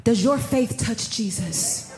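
ordinary talk

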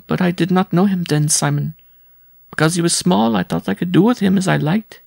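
high, lamenting woman telling her story; rueful woman visitor. A narrator's reading. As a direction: tell your misfortune in a high, rueful voice.

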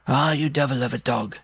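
high-voiced little man scolding; chiding dwarf. A narrator's reading. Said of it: "he would chide Saint Eustache".

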